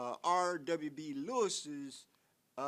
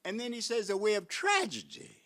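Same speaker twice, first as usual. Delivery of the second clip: ordinary talk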